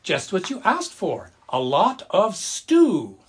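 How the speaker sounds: high, loud, slow reveal; proud grandpa. A narrator's reading. Grandpa proudly shows grandma the stew, high and slow. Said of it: "Grandpa said".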